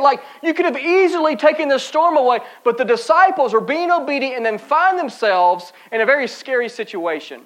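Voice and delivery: normal talking voice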